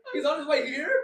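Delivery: laughing panic. Retold or imitated. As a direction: squeal it through laughter. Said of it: "I'm like".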